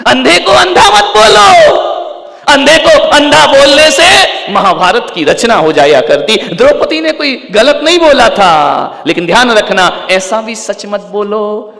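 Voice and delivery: very high, loud sermon shout; impassioned cry at the top of the voice; full-force preaching. Acted. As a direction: thunder the warning to the whole congregation.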